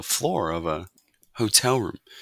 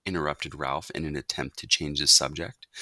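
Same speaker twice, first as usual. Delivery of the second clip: plain reading voice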